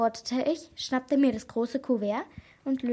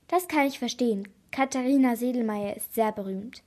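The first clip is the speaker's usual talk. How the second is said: plain reading voice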